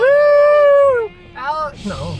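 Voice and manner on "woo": high, loud whoop; high, loud celebratory woo; excited cheer. Real, not acted. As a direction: whoop it out with joy. Cheering about the trip.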